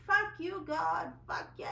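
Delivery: loud, high outburst breaking into a cry, slowing at the end; yelling at God. Retold or imitated. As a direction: let it out at God, voice cracking. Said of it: "I yelled at God".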